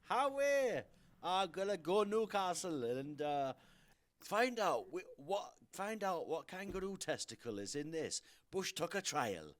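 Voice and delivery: hammed-up Geordie accent, high and loud. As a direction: belt out a bad Geordie impression. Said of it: "see, that was just terrible as well".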